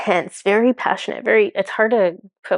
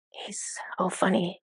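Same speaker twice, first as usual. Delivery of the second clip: a quick whispered aside, almost to herself; 10 dB quieter than usual. Real, not acted. Telling viewers about the god, whispered aside. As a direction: breathe it out as a private amused aside.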